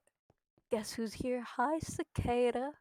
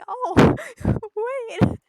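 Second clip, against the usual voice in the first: very loud, high cry of surprise; high, laughing exclamation; laughing outburst; 12 semitones higher, 14 dB louder than usual. Real, not acted. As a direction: burst out laughing in startled realisation. Sudden realisation, laughing outburst.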